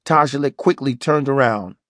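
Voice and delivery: usual speaking voice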